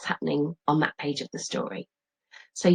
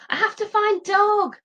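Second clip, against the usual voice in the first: urgent call. Acted. Shouting over storm.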